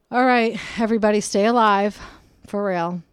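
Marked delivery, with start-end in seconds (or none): none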